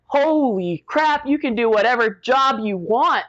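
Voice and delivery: impressed recruiter. Retold or imitated. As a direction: play the stunned recruiter. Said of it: "He's like".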